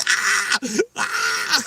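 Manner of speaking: two loud, high screams of fright; repeated screaming. Retold or imitated. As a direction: shriek twice in re-enacted panic. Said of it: "I just kept screaming".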